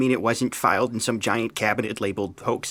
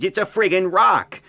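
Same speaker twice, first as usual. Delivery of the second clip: loud, higher frustrated cry; exasperated outburst; 7 semitones higher, 5 dB louder than usual. Acted. Jones yells at the agent over a rock, exasperated. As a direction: blow up at the absurdity.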